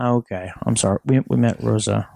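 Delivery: very low, sheepish mock voice; deep mock voice. Cohost told to drop the accent, answering very low. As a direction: drop way down and apologize. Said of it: "Stop the accent".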